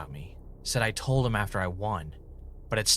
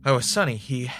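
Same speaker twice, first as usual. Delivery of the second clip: higher and louder, stumbling over the words; stammer, still terrified from the dream; frightened stammer; 7 semitones higher, 7 dB louder than usual. Acted. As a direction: shaken panicked babble. Ray panicked after nightmare.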